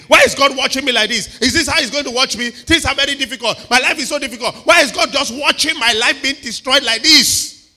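high, loud angry whining; angry complaint. Retold or imitated. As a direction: rant at God in frustration. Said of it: "They were very angry".

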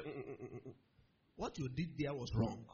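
low, measured disapproving murmur; disapproving God. Retold or imitated. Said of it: "God said".

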